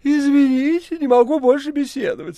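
high, loud, polite cat voice; a cat taking his leave. Acted. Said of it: "сказал кот".

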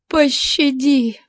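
high, drawn-out cry for mercy; desperate plea. A narrator's reading. Kneeling captive begs captor for mercy, high pleading. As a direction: beg in a high, slow woman's voice.

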